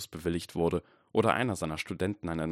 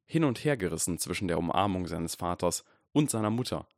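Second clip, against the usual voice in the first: usual speaking voice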